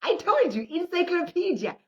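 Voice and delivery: high, loud proud "I told you"; crowing with glee, pitch way up; excited boast. Real, not acted. Boasting about her English.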